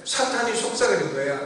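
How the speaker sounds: usual speaking voice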